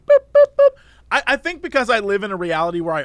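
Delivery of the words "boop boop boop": high, loud mock beeping; scanner beeps. Speaker shows scanning quickly, high loud beeps. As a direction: chirp like a fast scanner. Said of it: "I'm like".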